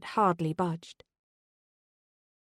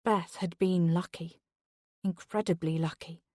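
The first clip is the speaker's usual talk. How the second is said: ordinary delivery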